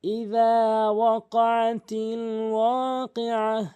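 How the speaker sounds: slow, drawn-out chanted recitation; slow reading. Shown as a demonstration. Teacher showing how slowly to read, slow chant. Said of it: "এভাবে slow করে আপনি পড়বেন".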